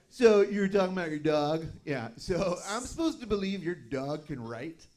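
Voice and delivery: mock incredulous questioning; sceptical millennial. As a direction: challenge it like a doubting audience member. Imitating sceptical millennials after show.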